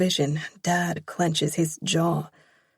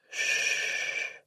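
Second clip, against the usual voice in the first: soothing shush; 7 dB quieter than usual. Acted. Father soothing crying daughter.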